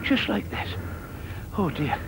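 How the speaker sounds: plain reading voice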